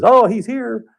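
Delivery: high, loud, eager "he's here"; a bright, excited announcement. Retold or imitated. Family member announcing the therapist's arrival, high and excited.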